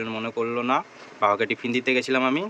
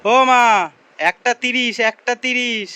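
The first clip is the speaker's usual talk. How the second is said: calling out; 10 semitones higher, 10 dB louder than usual. Real, not acted. Waking the sleeping mother.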